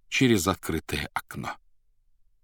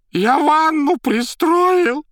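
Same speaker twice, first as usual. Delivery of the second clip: very high, loud, slow shriek; terrified shouting; 10 dB louder, much slower than usual. A narrator's reading. Bloodied informer defending himself, terrified high shriek. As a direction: shriek it out in panic. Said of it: "стуча зубами, кричал окровавленный Магарыч".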